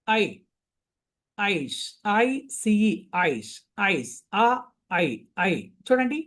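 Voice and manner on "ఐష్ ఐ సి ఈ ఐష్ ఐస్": ఐష్ drawn out letter by letter, very slow; slow spelling demo. Shown as a demonstration. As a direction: sound out ఐ సి ఈ slowly for pupils. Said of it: "ఇందులో మనకు చూడండి ఐష్".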